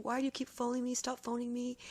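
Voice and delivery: mild mock scream from a frantic woman; echoing the neighbour's panicked cry; retold distraught scream. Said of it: "She's screaming".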